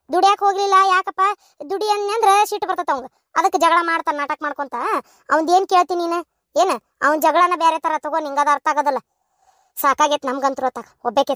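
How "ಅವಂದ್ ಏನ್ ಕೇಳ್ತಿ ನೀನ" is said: ordinary talk, nothing marked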